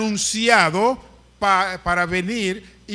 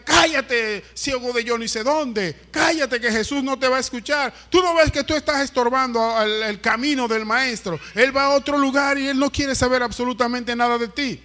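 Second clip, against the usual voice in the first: higher-pitched, acted telling-off; scolding rebuke; 4 semitones higher than usual. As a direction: act out shooing a beggar into silence. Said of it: "les reprendían". (regaño actuado)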